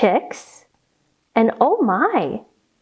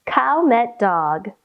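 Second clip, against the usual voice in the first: plain reading voice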